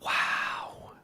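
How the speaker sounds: breathless whisper; just breathe the word out; whispered wow. Real, not acted. Awed reaction to prediction.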